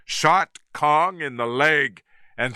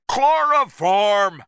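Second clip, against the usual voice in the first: higher, slow, loud call; shouted; 9 semitones higher, 4 dB louder than usual. A narrator's reading. Filmmaker shouting to the captain, loud and drawn out. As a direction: bellow the order across. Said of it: "Carl shouted to Engelhorn".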